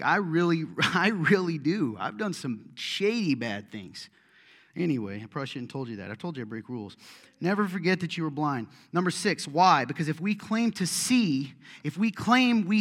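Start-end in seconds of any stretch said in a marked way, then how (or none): 0.0-2.0 laughing as he insists; insisting with a laugh; laughing insistence. Real, not acted. 7.4-9.5 louder, higher preaching build; rising sermon fervor; full-force preaching. Acted. 9.5-9.8 shouted why; loud rhetorical question. Real, not acted. Sudden loud question to crowd.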